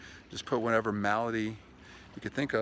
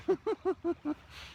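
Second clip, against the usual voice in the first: high laugh. Real, not acted. Laughs during vlog.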